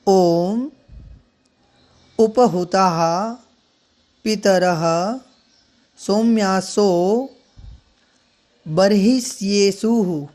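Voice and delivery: each word said slowly with long pauses between. Shown as a demonstration. Teacher demonstrating to students, word by word and slow. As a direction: spell out the mantra one word at a time. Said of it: "मैं शनैः शनैः उच्चारित करता हूँ".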